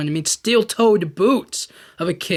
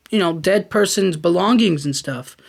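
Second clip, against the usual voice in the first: normal talking voice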